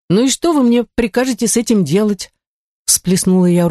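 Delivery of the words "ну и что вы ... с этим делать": higher, exasperated question; near despair. A narrator's reading. Artefactor facing a filthy ruined house, mildly despairing. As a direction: ask it trying not to despair. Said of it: "стараясь не впадать в отчаяние".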